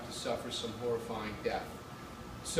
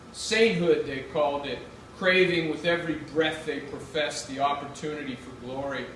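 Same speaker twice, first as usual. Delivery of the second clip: measured, louder reading-aloud voice; literary reading; 4 semitones higher, 8 dB louder than usual. A narrator's reading. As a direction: read it like a memoir on stage. Reads her essay aloud.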